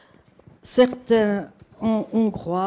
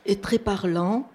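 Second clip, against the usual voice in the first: ordinary talk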